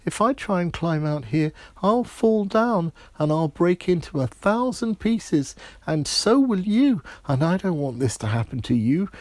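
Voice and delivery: put-on very high, loud, pleading little girl voice; scared princess. Princess afraid of falling.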